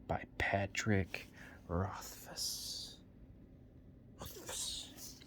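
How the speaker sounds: slow, drawn-out whisper; hushed whisper. Pretend. Crediting the book's author in a hushed whisper. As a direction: whisper the name, stretching it out.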